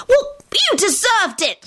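high-pitched indignant squeal; high, stretched-out defensive squeal. A narrator's reading. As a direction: squeal it in protest. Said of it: "Solange squealed".

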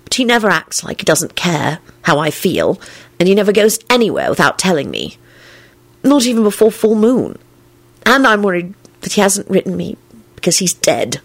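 understated dire worry. A narrator's reading. Woman fearing he is dead, dire. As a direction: voice a growing dread. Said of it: "she added direly".

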